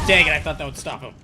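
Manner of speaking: loud frustrated groan of words; real exasperated shout; frustrated outburst. Real, not acted. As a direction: vent at a plan that failed. Attack fails against boss.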